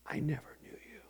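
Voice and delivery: whispered, low, as Jesus rejecting them. Retold or imitated. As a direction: breathe it out in a whisper. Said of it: "he's gonna say".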